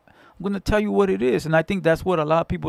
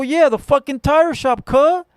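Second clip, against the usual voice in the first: cocky retort; 6 semitones higher, 4 dB louder, slower than usual. Retold or imitated.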